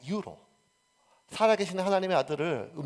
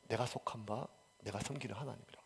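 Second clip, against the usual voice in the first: much softer, near-whispered and intimate; hushed confession; 8 semitones lower, 14 dB quieter than usual. Acted. Preacher softly echoing Paul's personal confession of faith. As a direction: drop to a reverent hush.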